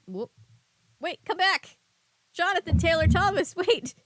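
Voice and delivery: high, loud laughing exclamation; surprised laughing outburst. Real, not acted. Breaks off reading, laughing.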